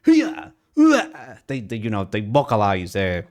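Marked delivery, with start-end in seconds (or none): 0.1-1.3 anime fighter grunts. Retold or imitated. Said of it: "They just go".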